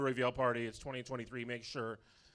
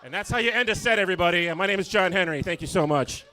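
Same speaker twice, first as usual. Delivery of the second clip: loud, raised-pitch closing shout to the crowd; loud sign-off; 6 semitones higher, 15 dB louder than usual. Real, not acted. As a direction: sign off big over the applause. Signing off over applause.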